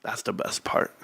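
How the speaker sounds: whispered, savouring "best part"; whispered glee at being right. Real, not acted. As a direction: breathe it like a secret. Savouring vindication.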